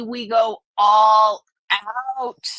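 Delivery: slow, loud, ALL punched hard; emphatic all out. Real, not acted. Host tells chat about Thanksgiving, loud slow emphasis. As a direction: shout "we go all out" slowly, hitting "all". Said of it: "And I mean".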